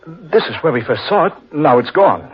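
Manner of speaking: startled dread at the empty spot; alarmed. Acted. Finding the killer tree gone.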